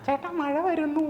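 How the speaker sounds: very high put-on woman's voice, slow; voice imitation. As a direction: squeak it out in a borrowed high voice. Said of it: "ആ voice ഒക്കെ imitation ആയിരുന്നോ". (ഉയർന്ന ശബ്ദത്തിൽ അനുകരണം)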